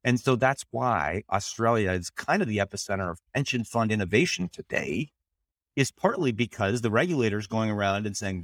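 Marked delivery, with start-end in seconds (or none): none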